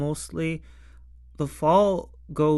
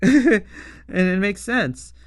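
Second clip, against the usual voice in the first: laughing; 4 semitones higher, 5 dB louder, slower than usual. Real, not acted. Laughing while talking.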